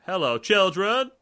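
loud, slow Chef greeting. Retold or imitated. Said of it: "Where he's like".

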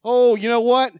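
usual speaking voice

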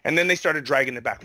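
normal talking voice

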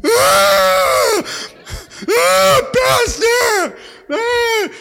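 loud, high hammed-up wailing cries; mock wailing. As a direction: wail at the pastor's door in mock despair.

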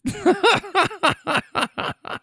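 high-pitched burst of laughter. Real, not acted. Laughing at his own story.

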